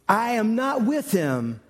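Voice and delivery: protesting retort, acted out; emphatic denial. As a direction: deny it firmly, leaning on each word. Peter's acted denial to the girl.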